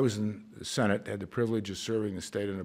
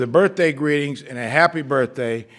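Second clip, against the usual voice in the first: loud birthday greeting; 5 semitones higher, 12 dB louder than usual. Retold or imitated. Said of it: "I would always end it with".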